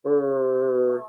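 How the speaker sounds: "Er" as a held R sound, low and soft; letter sound. Shown as a demonstration. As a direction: sound out "Er" on its own, drawn out. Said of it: "Which letter spells".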